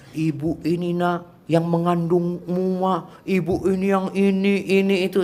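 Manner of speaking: mock motherly grumbling; complaining mother. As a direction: complain wearily to your child, stretching the words. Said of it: "dan jangan banyak mengeluh".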